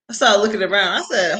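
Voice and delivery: normal talking voice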